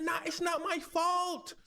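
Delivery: high, slow shouted denial; indignant denial. Retold or imitated. Mother denying blame to police, high slow shout. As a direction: yell it's not my fault, stretched out.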